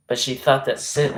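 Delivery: ordinary talk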